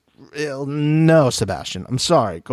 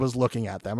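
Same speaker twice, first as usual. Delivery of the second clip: ordinary talk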